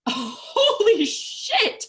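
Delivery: high, slow, laughing; excited disbelief. Real, not acted. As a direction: gasp it out in amazed delight. Seeing huge acceptance envelopes.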